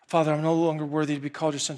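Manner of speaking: fast, nervous run-through; rushed rehearsal. Retold or imitated. Son rehearsing again, fast and nervous. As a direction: rush it like practicing. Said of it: "imagining how this conversation's gonna go".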